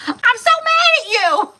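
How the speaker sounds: mock tantrum, loud and very high; hammed-up high shriek of anger; imitated angry yell. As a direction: yell it like a furious little kid. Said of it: "when she yells at me like that".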